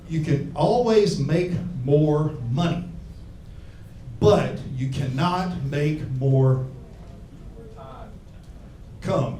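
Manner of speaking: slow, solemn quote with a long pause before the punchline; mock wise quote. Comic telling a crowd a famous saying, slow and weighty. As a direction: drag it out like a wise saying, then drop the last word. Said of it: "There was a famous guy who said".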